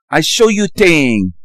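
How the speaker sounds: crude put-on Chinese accent, slowed down; mock Chinese accent. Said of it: "That's more of a Chinese ching chong".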